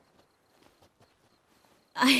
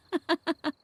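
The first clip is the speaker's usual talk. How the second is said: high giggle; 8 semitones higher than usual. Acted. Giggle after saying nude.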